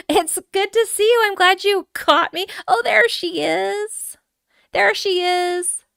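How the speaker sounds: high, loud, gushing welcome; excited singsong squeal, words stretched; delighted high greeting. Real, not acted. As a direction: coo with delight at spotting her. Greets viewer, spots the chicken.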